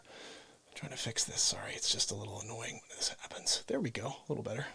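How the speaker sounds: whispering. Real, not acted. Fixing the stream, muttering.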